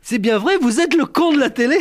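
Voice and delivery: high, loud rustic fan; gushing country woman. Retold or imitated. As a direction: ask the celebrity, starstruck. Wife addresses the narrator.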